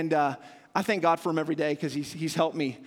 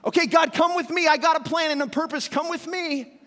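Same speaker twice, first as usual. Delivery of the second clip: pushy self-willed demand; 10 semitones higher, 5 dB louder than usual. Pretend. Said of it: "like the dog pulling the owner".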